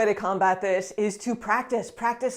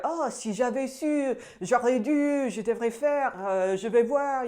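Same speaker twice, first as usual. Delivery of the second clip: French tense rattle; 4 semitones higher, slower than usual. Retold or imitated. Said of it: "you got your".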